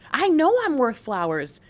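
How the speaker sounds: normal talking voice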